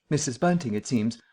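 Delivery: ordinary delivery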